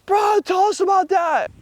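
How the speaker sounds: high shouted demand; excited shout. Retold or imitated. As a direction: friend hollering for the story. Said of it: "He's like".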